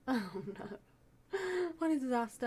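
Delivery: playfully dismayed self-quote; mock dismay. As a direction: act out a little disaster. Acts out own dismayed reaction.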